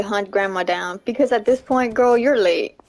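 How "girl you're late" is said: ordinary talk, nothing marked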